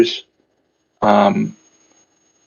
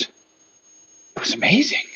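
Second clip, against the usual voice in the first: awed hushed whisper; 7 semitones higher, 5 dB quieter than usual. Acted. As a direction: breathe it out in a whisper. Overheard playgoer whispering in awe.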